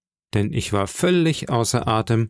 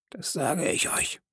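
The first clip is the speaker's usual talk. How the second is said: whispered threat; 7 semitones higher, 9 dB quieter than usual. Acted. Pirate leader warns whoever would touch the boy, whispering. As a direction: drop to a whisper to warn them. Silver's closing threat, unvoiced.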